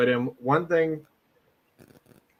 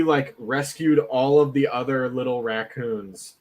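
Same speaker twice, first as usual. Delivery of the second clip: normal talking voice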